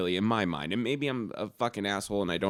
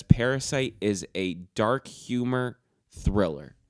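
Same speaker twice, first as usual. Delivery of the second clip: slow quoted read; slower than usual. Retold or imitated. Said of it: "the intro paragraph to that article says".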